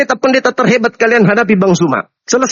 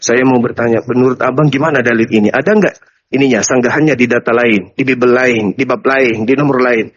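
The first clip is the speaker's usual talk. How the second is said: low, even, humble asking voice; polite questioner; 10 semitones lower than usual. Acted. Churchgoer coming up to ask Bang for answers, polite low questioning. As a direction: ask meekly in a much lower, level voice. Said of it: "Anda naik ke sini bertanya".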